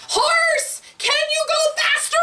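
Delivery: very high animal chorus call; one big loud voice. Acted. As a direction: call out as a whole gang of animals. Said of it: "All in one big loud voice".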